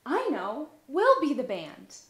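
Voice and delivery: loud, bright announcement, slowing on the band; proudly declaring a plan; eager child. Acted. Olivia telling her mother she will be the band, eagerly.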